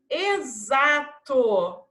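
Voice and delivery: "Exato" very slow, e-za-to with buzzing z. Shown as a demonstration. Teacher showing pupils X as Z, "Exato" slowly sounded out. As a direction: stretch each syllable of "Exato". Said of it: "Som de Z".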